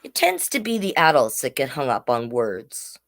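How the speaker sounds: ordinary delivery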